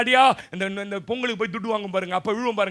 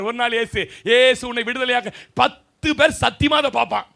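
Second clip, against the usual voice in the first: ordinary talk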